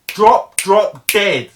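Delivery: bursts out loud on drop dead; loud emphasis. Real, not acted. To viewers, about his fear of dying, loud emphatic outburst. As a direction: hammer the words home, loud. Stressing how close death felt.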